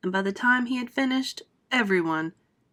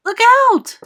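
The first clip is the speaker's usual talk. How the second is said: much higher, loud warning shout; alarmed cry; 13 dB louder than usual. Acted. James warning the falling centipede, a loud alarmed shout. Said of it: "cried James".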